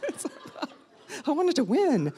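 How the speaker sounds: helpless high-pitched laugh in the words; amused, laughing self-mockery; laughing confession. Real, not acted. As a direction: admit it through your own laughter.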